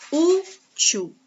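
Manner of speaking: "Útil" with a high, held Ú; stressed first syllable. Shown as a demonstration. Teacher showing the tonic syllable, "Útil" broken after a high Ú. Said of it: "O U é a sílaba tônica".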